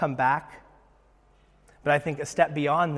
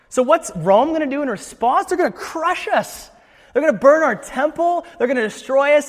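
raised, urgent pitch, acting out alarm; alarmed leaders; 7 semitones higher, 4 dB louder than usual. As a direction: voice the council's mounting alarm. Voices leaders fearing Rome's crackdown.

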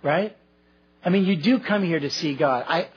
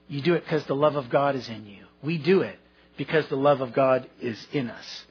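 normal talking voice

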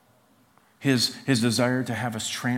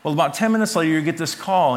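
ordinary talk, nothing marked

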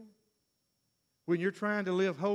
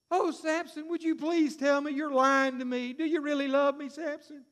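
Delilah begging; 7 semitones higher, 3 dB louder than usual. Retold or imitated.

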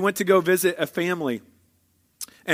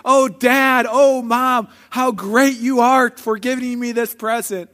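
higher, louder mock-thankful kid; gushing gratitude; 5 semitones higher, 4 dB louder, slower than usual. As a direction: gush like an overjoyed child thanking parents. Imagined grateful kids on perfect Christmas.

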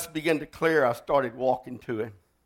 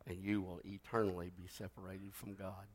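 solemn altar call; 6 semitones lower, 9 dB quieter than usual. Acted. Preacher closing the sermon to the congregation, hushed pleading. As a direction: lean in and plead quietly.

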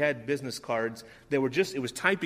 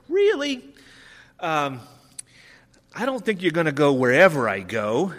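challenging Jesus; 4 semitones higher, 7 dB louder than usual. Retold or imitated. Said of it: "Jesus challenges him".

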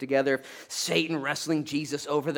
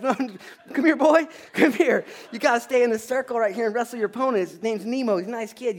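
much higher, loud coach's holler through laughter; laughing call-out; 8 semitones higher, 5 dB louder than usual. Retold or imitated. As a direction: call the runaway kid back to the circle. Coach calling boy back to mat.

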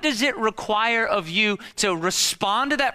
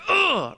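high, loud mock "ugh"; frustrated groan; 10 semitones higher, 6 dB louder than usual. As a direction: groan out a wife's bottled-up frustration. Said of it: "her feelings are".

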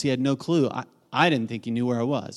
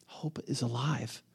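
hushed refrain; 9 dB quieter, slower than usual. Real, not acted. Sermon climax refrain.

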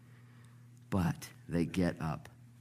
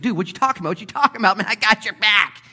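high, loud, cocky rebuttal; indignant protest; 12 semitones higher, 10 dB louder, faster than usual. Acted. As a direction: protest like an offended buddy. Peter protesting to Jesus.

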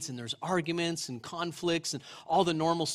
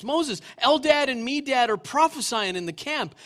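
reading the verses louder and higher, building; fervent scripture reading; 5 semitones higher, 6 dB louder than usual. Acted. Preacher reading Numbers to the congregation, building fervour. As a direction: proclaim the scripture with rising fervour.

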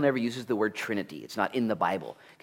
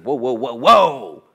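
whoas building to a loud, high last one; booming heavenly interjection; 3 semitones higher, 14 dB louder, slower than usual. Acted. The Father's voice breaks in from heaven, building exclamation. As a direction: call out "whoa" louder and higher each time. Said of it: "this voice comes down from the Father saying".

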